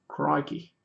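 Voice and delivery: one-word mock Aussie accent; Australian accent attempt. Shown as a demonstration. Streamer proving he could do one, Australian accent. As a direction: toss out a token Australian accent. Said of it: "Oh, I could do one".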